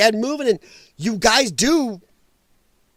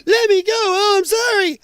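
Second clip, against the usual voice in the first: very high, crying, begging voice; hammed-up tearful apology; mock whimpering plea; 12 semitones higher, 4 dB louder than usual. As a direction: whine it like a tough guy caught and sobbing. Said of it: "Then they're".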